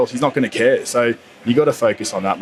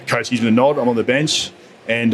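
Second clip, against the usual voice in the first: ordinary talk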